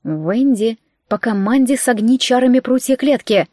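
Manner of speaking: plain reading voice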